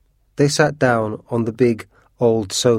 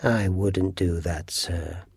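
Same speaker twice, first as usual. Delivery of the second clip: slow, low and gloomy warning; gloomy tones; 3 semitones lower, 6 dB quieter, slower than usual. A narrator's reading. Housekeeper warns Washington against cleaning, in gloomy tones. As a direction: sink into a soft, doom-laden warning. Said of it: "said Missus Umney in gloomy tones".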